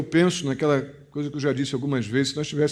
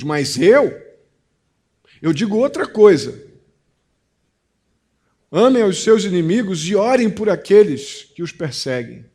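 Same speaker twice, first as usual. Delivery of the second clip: raised, forceful preaching declaration; louder and higher, proclaim it as a firm command; emphatic quoted Jesus; 4 semitones higher, 6 dB louder than usual. Acted. Preacher quotes Jesus countering teachers.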